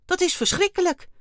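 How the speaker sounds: very high, loud outcry; horrified exclamation. A narrator's reading. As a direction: cry out aghast. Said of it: "riep hij uit". (ontzet uitroepen)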